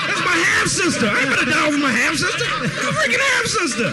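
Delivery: high, loud, laughing panic; panicked. Retold or imitated. Fleeing man panicking about his half sister, high and laughing. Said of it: "I was like".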